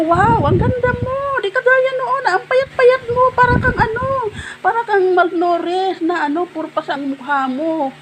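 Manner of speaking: very high, slow, delighted squeal; squealing compliment. Retold or imitated. Complimenting Amor on her new look, squealing high. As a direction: squeal over her like a gushing friend. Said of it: "Sabi ko".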